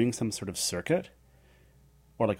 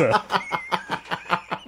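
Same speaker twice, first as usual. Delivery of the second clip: high bubbling laugh; real laugh; 11 semitones higher than usual. Real, not acted. Mother laughing while telling the story, high and bubbling. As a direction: laugh at the memory.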